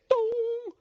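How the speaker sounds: high sung ding-dong chime; call button chime. Retold or imitated. Comedian imitating the call button chime, high and sung. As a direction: ring it out like a plane call bell.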